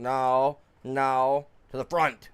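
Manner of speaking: loud insistent no, no; angry shout. Real, not acted. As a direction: bark the order in anger. Yelling at machine to move.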